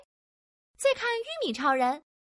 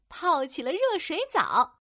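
ordinary delivery, nothing marked

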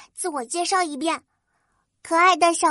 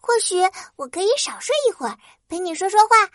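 touched; 4 semitones higher, faster than usual. Acted. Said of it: "花仙子感动极了".

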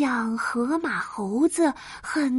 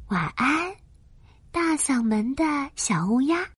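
bedtime goodnight. A narrator's reading.

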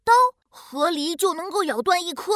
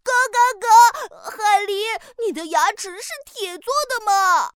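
astonished; 6 semitones higher, 4 dB louder than usual. Acted. Duck astonished.

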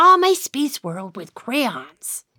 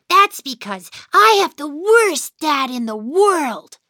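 usual speaking voice

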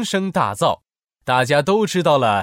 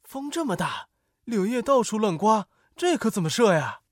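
soft, high-pitched fretting; anxious onlooker, voice raised in pitch; worried; 6 semitones higher, 4 dB quieter than usual. A narrator's reading. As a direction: worry aloud like an anxious soldier. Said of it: "士兵们担心地说".

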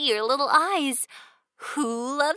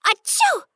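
mock sneeze; 8 semitones higher than usual. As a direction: act out a big sneeze. Mom sneezes very hard.

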